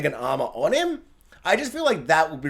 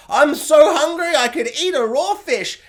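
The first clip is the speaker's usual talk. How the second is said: mock hungry peasant; 10 semitones higher, 6 dB louder than usual.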